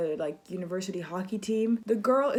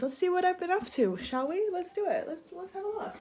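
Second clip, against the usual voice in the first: higher, playful sing-song; sing-song excited; 9 semitones higher than usual. Real, not acted. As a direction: sing it out to the viewers.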